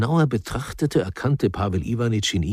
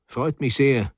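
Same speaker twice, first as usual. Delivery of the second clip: usual speaking voice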